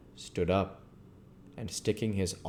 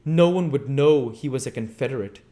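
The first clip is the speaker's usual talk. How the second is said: eager and louder; 7 semitones higher, 10 dB louder than usual. Acted. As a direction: press the point eagerly. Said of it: "said the Invisible Man eagerly".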